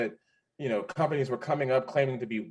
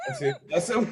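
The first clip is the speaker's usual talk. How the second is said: normal talking voice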